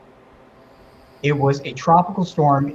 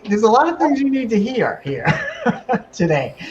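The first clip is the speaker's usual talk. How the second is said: laughing; 10 semitones higher than usual. Real, not acted. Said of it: "laughing through the line".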